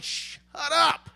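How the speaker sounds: high snapped mock shout; annoyed retort. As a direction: bite back at the advice. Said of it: "You're like".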